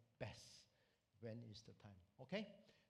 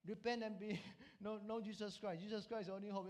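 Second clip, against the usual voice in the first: higher-pitched public plea, a little louder; mustering courage to preach; 7 semitones higher, 4 dB louder than usual. Acted. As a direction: call her to repent, pitched up. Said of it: "stood up and he speak to the woman".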